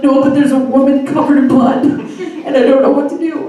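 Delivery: loud, high, frantic explanation; hammed-up phone freak-out; retold panic. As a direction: plead helplessly, pitch and volume up.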